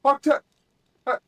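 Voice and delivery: high, loud panicked calls; shouting. Acted. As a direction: yell out for a missing ally. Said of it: "like I'm shouting to one of my comrades".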